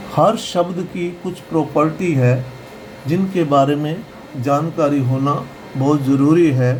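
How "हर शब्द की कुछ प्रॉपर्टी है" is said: normal talking voice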